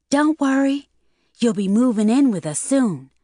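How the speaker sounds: lightly voiced young woman. Loretta teasing Mel.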